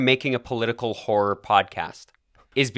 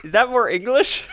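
attempted Kiwi accent; 11 semitones higher than usual. Shown as a demonstration. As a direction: try a New Zealand accent, pitched up. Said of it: "A little boy is speaking like a Kiwi".

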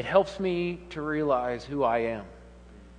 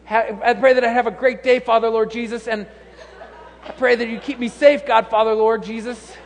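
mock fervent prayer; 6 semitones higher, 6 dB louder than usual. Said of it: "And so".

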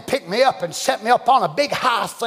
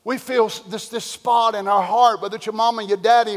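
ordinary talk

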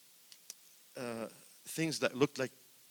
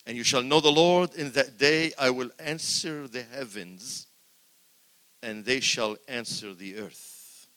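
emphatic scripture reading; 7 dB louder, slower than usual. Acted. Preacher reading scripture with force.

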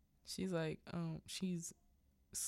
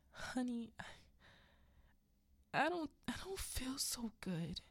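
wheedling hurt husband; 8 semitones higher than usual. Retold or imitated. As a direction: play up the injury to win her sympathy. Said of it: "He says it just like that".

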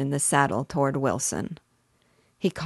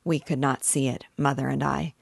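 ordinary delivery

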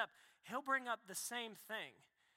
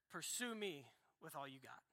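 calm voice of God; 6 semitones lower, 8 dB quieter than usual. Acted. God answering the believer who wants a detailed plan, low and calm. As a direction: lower and softer, firm and unhurried.

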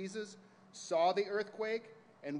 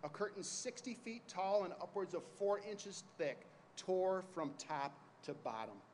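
ordinary talk

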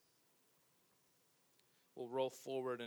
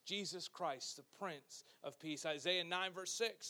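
ordinary talk, nothing marked

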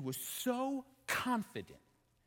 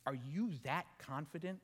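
normal talking voice

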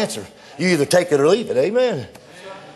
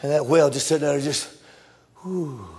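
ordinary talk

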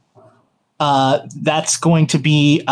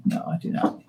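whispering; 12 dB quieter, faster than usual. Real, not acted. GM muttering to self over dice.

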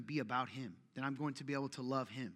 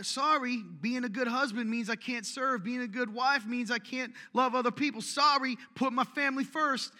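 loud, higher mock apology; mock excuse; 8 semitones higher, 7 dB louder than usual. Preacher voicing a member's family excuse, loud hammed-up whine. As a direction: ham up the sorry-not-sorry. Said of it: "use the excuse of your family".

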